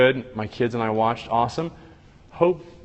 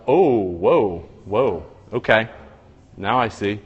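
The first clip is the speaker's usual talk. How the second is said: ordinary talk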